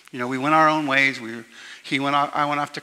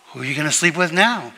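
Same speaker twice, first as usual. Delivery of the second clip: teasing laugh; 3 semitones higher, 6 dB louder than usual. Retold or imitated. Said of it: "everybody was saying".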